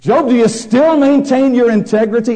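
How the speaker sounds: loud, high sermon voice, pressing each phrase; full-force preaching. Acted. Preacher to congregation, loud fervent preaching. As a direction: thunder it from the pulpit.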